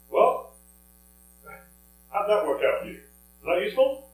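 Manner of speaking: acted challenge, voice lifted and punchy; pointed challenge. Jesus challenging the fishermen who caught nothing, pointed and loud.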